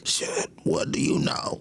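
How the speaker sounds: high, unvoiced whisper of alarm. Reader wondering what Doflamingo knows, hushed whisper. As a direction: breathe it out in a hushed panic. Inner thought of worried reader.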